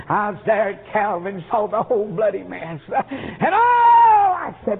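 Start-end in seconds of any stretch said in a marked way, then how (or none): 2.9-4.4 high wailing cry. Real, not acted. Preacher overcome by the demon story, high wailing oh. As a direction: let the oh break into a wail.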